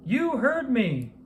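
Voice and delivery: high, slow stern call. A narrator's reading. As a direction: call out firmly and slowly. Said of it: "called out George".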